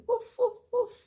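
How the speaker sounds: put-on high, loud playful woof for a child; dog barking. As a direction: bark like a cheerful little dog. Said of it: "What does a dog say?".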